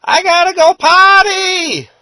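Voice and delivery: high, loud imitation of a little boy yelling; drawn-out, high-pitched kid holler; loud child's yell. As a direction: yell it out like a toddler calling for Mommy. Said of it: "he yells out really loud".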